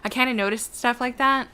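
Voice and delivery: ordinary talk, nothing marked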